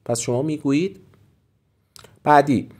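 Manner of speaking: normal talking voice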